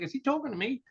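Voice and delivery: high, soft, baffled question; puzzled. Retold or imitated. Driver wondering who the yelling is for, puzzled.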